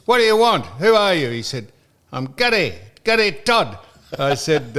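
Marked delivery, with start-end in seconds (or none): 0.1-1.3 louder, higher retold demand; brusque challenge. Retelling his reply to a suspected hoax caller, loud and brusque. 2.1-3.8 imitated Scottish accent; Gary Todd. Retelling how the caller gave his name, mock Scottish accent. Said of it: "he's Scottish".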